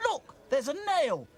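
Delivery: loud, high shout from the crowd. A narrator's reading. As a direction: call out the discovery. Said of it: "Someone in the crowd shouted".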